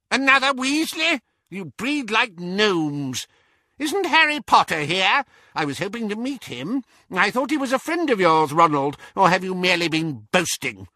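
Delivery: very high, bad-tempered old woman; rude elderly witch, scornful. A narrator's reading. Great-aunt accusing Ronald of boasting, high and scornful.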